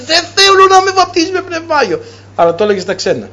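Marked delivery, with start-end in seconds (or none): 0.1-2.0 a man crying out against his baptism; retold shouting. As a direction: shout it as a stubborn refusal. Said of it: "άρχισε να φωνάζει".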